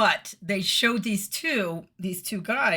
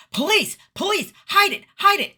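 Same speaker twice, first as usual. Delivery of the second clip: high, loud squeal of fake alarm; mock panic; 10 dB louder than usual. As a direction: squeal it like panicked teens hiding a drink. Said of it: "as a joke, we went".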